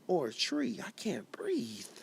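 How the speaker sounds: slow, breathy whisper, nearly gone; a choked, fading whisper. Acted. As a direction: let the words barely escape, slowly. Spoken word poem, hushed refrain.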